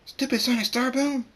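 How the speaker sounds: high, loud cry of annoyance; Trixie screaming. A narrator's reading. Trixie curses the sonic boom, screaming. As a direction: scream in pain and irritation. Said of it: "Trixie screams".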